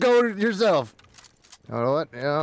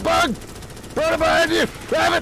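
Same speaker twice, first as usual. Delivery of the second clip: shouted warning; 10 semitones higher than usual. Acted. Warning teammate mid-fight.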